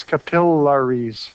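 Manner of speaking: slow capillaries spelled out by sound, "kuh-PILL-uh-reez"; stress on second syllable. Shown as a demonstration. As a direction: stretch PILL in capillaries as if writing it. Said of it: "stress goes on the syllable just before".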